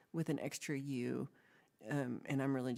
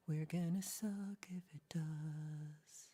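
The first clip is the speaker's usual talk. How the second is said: whisper; 5 dB quieter, slower than usual. Acted. Whispered repeat.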